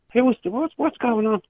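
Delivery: high, shrill-free startled cry of the wife. Retold or imitated. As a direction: retell her sudden shock at the church.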